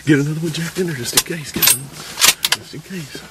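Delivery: loud excited urging; pumped up after the shot. Real, not acted.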